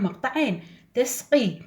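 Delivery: ordinary talk, nothing marked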